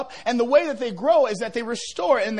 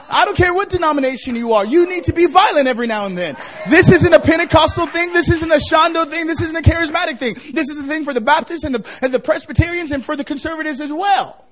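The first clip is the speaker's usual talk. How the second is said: building, louder preacher's call; preaching at full force; 2 semitones higher, 6 dB louder than usual. Acted. Pastor preaching to the congregation, rising loud and forceful. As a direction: press it on the congregation.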